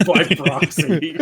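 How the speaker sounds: slow, laughing "By proxy.". Real, not acted. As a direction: chuckle the words "By proxy." out slowly. Players laugh at joke.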